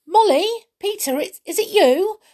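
high, loud joyful calling; Binky gladly calling to his friends; glad cry. A narrator's reading. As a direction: cry out in relief at finding them. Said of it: "cried a voice gladly".

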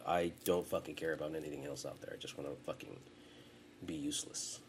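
low, soft, deflated delivery; quietly imitating someone who gave up; apathetic mumbling quote. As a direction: act checked out, voice sinking low. Voices giving up on everything.

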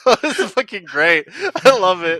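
ordinary talk, nothing marked

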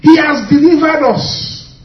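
loud and slow proclamation; proclaiming. Acted. Preacher proclaims deliverance to the church.

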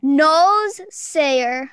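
careful puzzle read; slow sounding out. Shown as a demonstration. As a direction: slowly read nose sayer. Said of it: "game reading".

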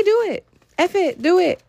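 high, loud pep-talk push; excited urging. Real, not acted. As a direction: hype them up to just go. Urging listeners to leave hometown.

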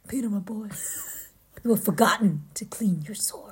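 breathy, whispered admonition; hushed gentle reproach. A narrator's reading. Reader as Aslan to Peter, whispered reproach. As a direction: whisper it as a solemn aside.

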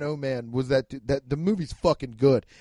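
usual speaking voice

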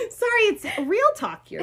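high, real laughter through the apology; laughing apology to her guest. Real, not acted. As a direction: laugh it off after crying. Laughs after tears.

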